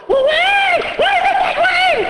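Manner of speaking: put-on high, loud kid yelling stop; a child frantically cutting in; shouting wait. Child shouts at the reader to stop, high and loud.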